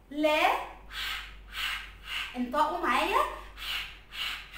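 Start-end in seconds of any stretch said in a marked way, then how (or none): 0.9-2.4 ح as a voiceless throaty puff, repeated; letter sound demo. Shown as a demonstration. Teacher asking pupils which letter this is, sounding out ح. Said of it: "حرف ايه ده؟". 3.6-4.6 ح as a voiceless throaty puff, repeated; letter sound demo. Shown as a demonstration. Teacher asking pupils which letter this is, sounding out ح. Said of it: "حرف ايه ده؟".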